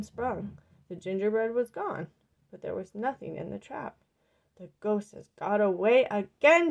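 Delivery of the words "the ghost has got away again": loud frustrated yell. A narrator's reading. Old woman yelling over the empty trap. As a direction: yell it out in exasperation. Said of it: "she yelled".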